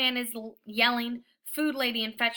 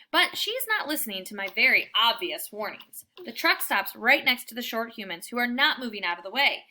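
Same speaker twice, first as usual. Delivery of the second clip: plain reading voice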